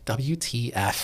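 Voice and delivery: slow, drawn-out disbelief; mock stunned W T F. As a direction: spell it out slowly, stunned. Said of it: "And I said".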